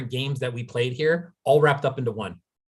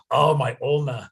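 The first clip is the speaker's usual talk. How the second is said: mock whimper; 2 semitones higher than usual. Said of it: "walking around going".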